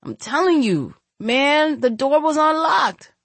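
desperate pleading. Acted. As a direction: beg them to believe you. Intruder pleading after a punch.

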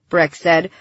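ordinary delivery, nothing marked

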